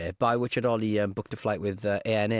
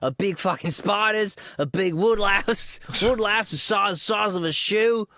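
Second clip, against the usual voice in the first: hammed-up Australian accent, higher and slowed; mock Australian; 7 semitones higher, 3 dB louder than usual. Cohost parodies Australians boasting about huge bugs, hammed-up Australian accent. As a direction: play a boastful Aussie bloke, over the top.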